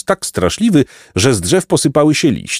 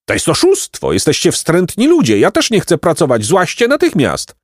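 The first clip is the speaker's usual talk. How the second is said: higher-pitched, outraged donkey tirade; indignant protest; 8 semitones higher than usual. A narrator's reading. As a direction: protest angrily without raising the volume. Osioł oburzony, kłóci się z chłopcami. (oburzony, podniesiony ton)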